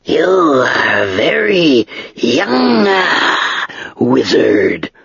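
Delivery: slow, drawn-out, dry dragon voice; great dry voice of an ancient dragon. Acted. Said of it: "the dragon said".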